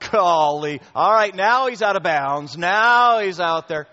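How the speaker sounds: high, loud hammed-up protest; mock offended adulterer. Pastor imitates adulterers complaining, mock outrage. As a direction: play the scandalised sinner big and loud. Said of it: "the adulterers don't go".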